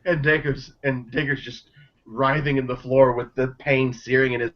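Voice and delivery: normal talking voice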